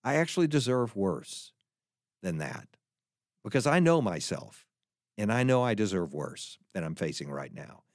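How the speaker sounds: humble confession. Shown as a demonstration. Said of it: "our first response is to say".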